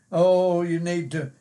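ordinary delivery

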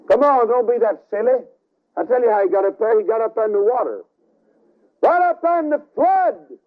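preacher scolding skeptics, rising to a cry; preaching at full force. Acted. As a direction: hammer the point home to the doubters. Preacher rebukes doubters, building.

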